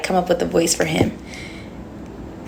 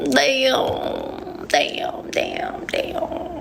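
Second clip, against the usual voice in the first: each damn softer until whispered; fading damn; 5 semitones higher, 6 dB quieter, much slower than usual. Real, not acted. Cursing the ending stream, fading away. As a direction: let it die out to a whisper.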